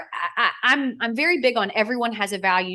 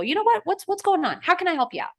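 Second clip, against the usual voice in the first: high-pitched, eager helper; imagined friendly offer; 8 semitones higher, faster than usual. Retold or imitated. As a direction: pitch it up, offering help like a kid. Voices offering help like her son.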